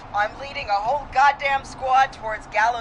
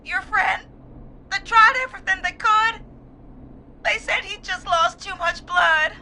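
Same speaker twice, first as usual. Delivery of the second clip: grief-stricken; 8 semitones higher than usual. Acted. Caller explains friend's death from blood loss, grief-stricken.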